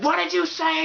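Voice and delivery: put-on high, threatening girl; sassy high voice. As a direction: demand it with menace. Girls confront the guy.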